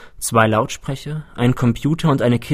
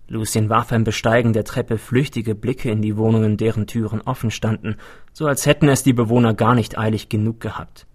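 usual speaking voice

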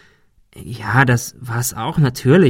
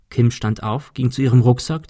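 plain reading voice

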